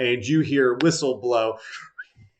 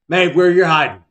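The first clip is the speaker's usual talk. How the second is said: loud, raised-pitch holler; teacher's shout; 5 semitones higher, 9 dB louder than usual. Acted. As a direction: shout for a student who's hiding. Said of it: "he shouts through a number of other".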